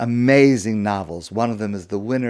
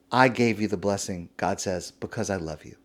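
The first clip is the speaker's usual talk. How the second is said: soft, lower, loving; tender God; 4 semitones lower, 7 dB quieter than usual. Acted. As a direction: say it gently, full of love. God's loving words repeated.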